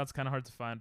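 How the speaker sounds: ordinary talk, nothing marked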